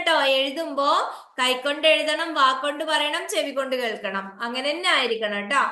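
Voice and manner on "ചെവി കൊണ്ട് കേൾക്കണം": ordinary delivery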